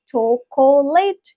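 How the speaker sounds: "chocolate" split as cho-co-late; three-part pronunciation. Shown as a demonstration. Teacher shows it divided in three, wrong pronunciation. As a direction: say all three beats of "chocolate". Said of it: "It's not divided in three words".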